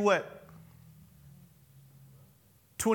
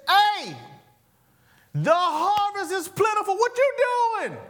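put-on much higher, louder exasperated call to his boys; exasperated Jesus; 13 semitones higher, 4 dB louder than usual. Jesus scolding his disciples, high and exasperated. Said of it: "I'm not saying he said it in this tone".

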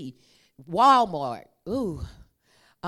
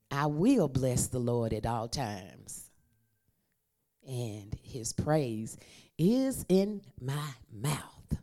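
slow solemn reading; 5 semitones lower, slower than usual. Acted. Slow scripture recitation.

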